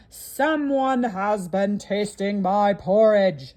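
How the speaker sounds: loud, very slow gruff papa bear; great gruff voice. Acted. Papa bear finds his porridge tasted, great gruff voice. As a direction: boom it out slowly like a big gruff bear. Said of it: "in his great gruff voice".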